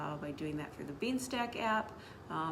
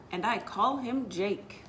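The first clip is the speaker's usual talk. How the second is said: put-on bouncy storytime narration for kids; animated children's story narration; lively read-aloud; 4 semitones higher, 4 dB louder than usual. A narrator's reading. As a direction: read it like a picture book to children. Reads picture book aloud.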